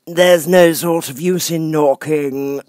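high, slow, lofty footman voice; frog footman. Acted. Frog footman dismissing Alice at the door, lofty. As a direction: talk down to her from on high.

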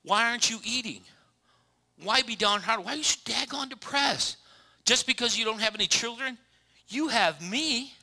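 high, loud goofy husband voice; mock clueless husband. A clueless husband scolding his sad wife, high and loud. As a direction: ham up a clueless husband pleading.

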